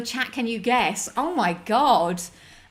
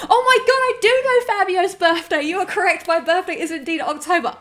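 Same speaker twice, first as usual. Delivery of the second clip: excited; 9 semitones higher, 6 dB louder than usual. Real, not acted. Excited recognition of birthday.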